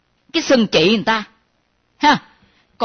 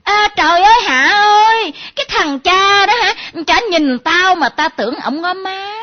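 high, loud gossiping squeal; mocking yell to a friend about a man; mock shriek; 7 semitones higher, 4 dB louder than usual. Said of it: "la lên".

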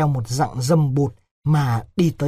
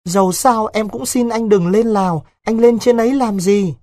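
put-on high, louder anxious young woman; pleading village girl; 6 semitones higher, 4 dB louder than usual. Vịnh asks Đối why he must go to Laos, pleading. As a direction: plead with him in a raised, higher voice.

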